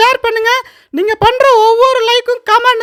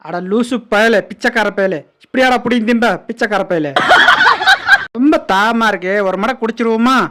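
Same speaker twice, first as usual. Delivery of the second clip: deep gruff animal voice; 14 semitones lower than usual. Acted.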